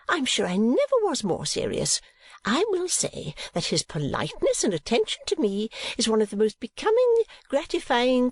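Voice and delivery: high, fussy mother in full flow; indignant, gushing Mrs. Nickleby. A narrator's reading. Mother defending her praise to Kate, high and emphatic. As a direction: protest high, then heap on praise.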